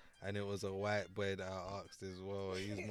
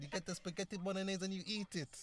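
put-on high-pitched mafia accent; Italian-American mobster; 10 semitones higher than usual. British actor hamming up the gangster, Italian-American accent. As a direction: order food like a movie mafioso. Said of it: "You got the Italian".

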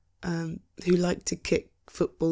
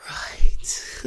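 whisper; 7 dB louder than usual. Acted. Realising the bad day.

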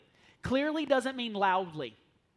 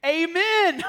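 louder, higher imitated shout of amen from the back row; retold scream; 8 semitones higher, 10 dB louder than usual. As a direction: belt out the amen like the man in the back. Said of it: "he screamed".

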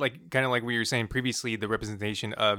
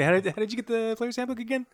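much higher teasing question; 12 semitones higher than usual. Real, not acted. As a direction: poke at him with a high lilt.

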